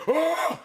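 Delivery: high, loud cackling yelp; toothless uncle's laugh. Retold or imitated. Toothless uncle laughing from the front row, loud high whoop. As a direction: imitate a shrill cackling guffaw. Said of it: "and I hear".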